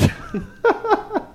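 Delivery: high burst of laughter; high-pitched giggle. Real, not acted. Laughs after the apology.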